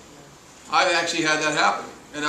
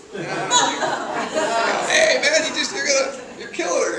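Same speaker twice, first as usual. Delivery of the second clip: higher, louder, laughing through the words; laughing tease; 9 semitones higher, 5 dB louder, much slower than usual. Retold or imitated. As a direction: rib a buddy while cracking up. Mocking fireman who tasted it.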